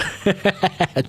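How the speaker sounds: real high-pitched chuckle; laughing. Real, not acted. Streamer amused by a chat comment, real laughter. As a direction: laugh it off in a quick high burst. Amused after mock offence.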